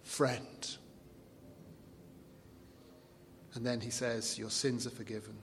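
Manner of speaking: soft, gentle words of pardon; tender Jesus. Retold or imitated. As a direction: speak forgiveness kindly, close and low. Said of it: "give you a sampling of how it feels".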